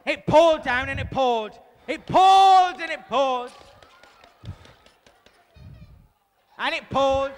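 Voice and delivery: loud, slowing dramatic storm reading; dramatic booming narration. Acted. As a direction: build the storm up loudly.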